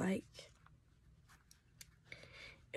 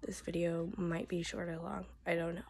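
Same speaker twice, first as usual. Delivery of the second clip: hoarse, half-whispered voice; losing her voice, pushing through; voice giving out. Real, not acted. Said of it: "am losing my voice".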